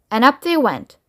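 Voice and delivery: usual speaking voice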